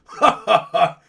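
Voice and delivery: loud hearty mock laugh; mock villain laugh. Bokashi answers the warlock with a big mock laugh. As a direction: throw out a big booming ha ha ha. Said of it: "And then Bokashi goes".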